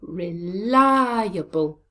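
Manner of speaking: exaggerated stress on li in Reliable; stress on second syllable. Shown as a demonstration. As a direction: hit and hold the LI in Reliable. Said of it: "Where is the stress in this word?".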